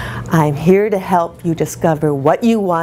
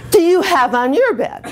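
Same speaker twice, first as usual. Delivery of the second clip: high-pitched mock-husband whine; higher, playful imitation of a whining husband; attention-seeking whine; 11 semitones higher than usual. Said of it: "he quickly jumped to two star".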